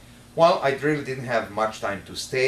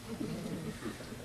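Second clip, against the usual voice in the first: soft laugh; 9 semitones higher, 12 dB quieter than usual. Retold or imitated. Host recalls their first meeting.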